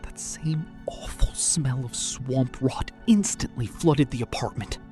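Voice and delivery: plain reading voice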